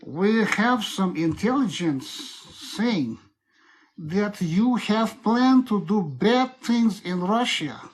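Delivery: put-on slow, deliberate Russian accent. Telling a suspect he was caught, Russian accent. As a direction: interrogate slowly in a Russian accent. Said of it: "So Russian accent is like this".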